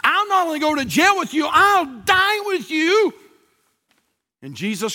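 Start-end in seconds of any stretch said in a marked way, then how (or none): 0.0-3.1 impassioned high, loud preacher's pledge; high-pitched fervent declaration; fervent vow. Acted. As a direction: cry it out as a bold promise, stretched at the end. Acts out Peter's vow.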